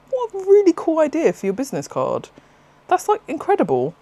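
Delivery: replaying her delight to the stall owner; retold excited praise. Praising Tim's seed-paper business card, retold gushing delight. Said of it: "And I was like".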